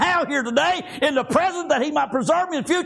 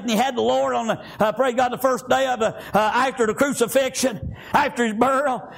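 normal talking voice

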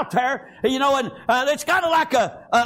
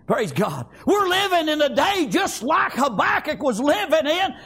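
normal talking voice

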